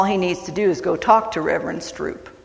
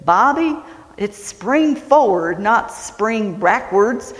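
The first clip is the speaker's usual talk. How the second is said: put-on Hank's Texas accent; 3 semitones higher, slower than usual. Texan dad corrects his son in the car, slow Southern accent. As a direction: stretch the words out in a Texan drawl. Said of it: "when I do a Southern accent".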